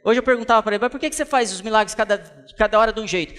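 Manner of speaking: high, loud pulpit fervour; impassioned sermon voice; fervent preaching. Acted. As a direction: drive the message home to the crowd. Preaching God's answer, rising fervour.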